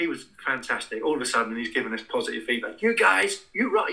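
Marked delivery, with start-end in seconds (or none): 2.8-3.9 high, loud hammed-up American cheer; mock American enthusiasm, pitched high; over-the-top American. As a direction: gush like an overeager American coach. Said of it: "really over the top American".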